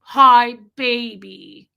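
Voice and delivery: put-on slow, syrupy baby talk to a grown son; mother-in-law cooing on speakerphone, slow and syrupy; creepy doting mother. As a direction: drip with sugary fake sweetness, drawn out. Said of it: "It was so creepy".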